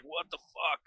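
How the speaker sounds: soft, groggy retold reaction; quiet, understated bewilderment; non-confrontational. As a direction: act out just waking, too sleepy to argue. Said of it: "so non-confrontational".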